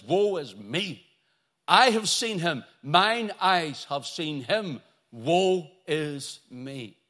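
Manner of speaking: slow, weighty declamation; prophetic lament. Acted. Preacher voices awestruck Isaiah before the throne, slowly lamenting. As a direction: proclaim it slowly, swelling on the sight of Him.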